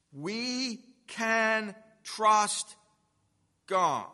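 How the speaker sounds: we can trust, stretched very slow, then God hit hard; drawn-out stress on trust. Acted. Preacher assuring believers, slow stress on trust. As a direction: lean slowly into trust and land on God.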